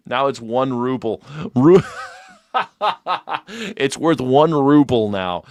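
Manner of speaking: ordinary delivery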